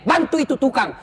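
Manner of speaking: ordinary talk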